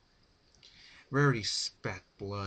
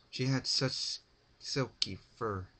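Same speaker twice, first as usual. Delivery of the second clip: plain reading voice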